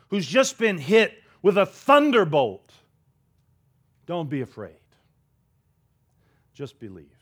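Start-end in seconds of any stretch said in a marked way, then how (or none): 4.1-7.1 hushed, gentle comfort to a broken man; quietly steady him, almost under the breath; soft reassurance. Acted. Jesus softly comforts the grieving father, hushed and gentle.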